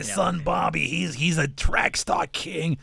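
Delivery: high, slow bragging mother; smoker Brooklyn mom. Acted. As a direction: boast about your son to the whole bar. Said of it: "this woman who just smokes a few too many packs".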